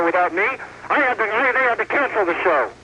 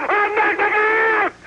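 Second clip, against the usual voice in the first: higher, slow stretched yell; drawn-out shout; 10 semitones higher, much slower than usual. Real, not acted. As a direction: stretch the threat out slowly. Repeat, even higher and drawn out.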